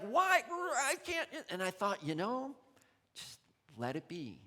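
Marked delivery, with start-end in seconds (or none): 0.0-1.3 high, whining complaint; whiny protest. Retold or imitated. Inner voice resisting cooking fresh rice, high whine. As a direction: pitch it up like a sulky child. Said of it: "this piece of me going".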